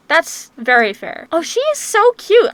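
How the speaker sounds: ordinary delivery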